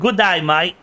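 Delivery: overdone attempt at Australian; bad Australian accent. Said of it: "I can't do an Aussie accent".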